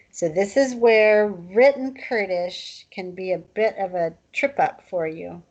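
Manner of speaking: usual speaking voice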